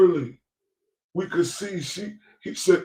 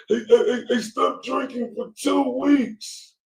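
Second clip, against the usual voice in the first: mock crying woman; 9 semitones higher, slower than usual. Commentator mimics the crying woman.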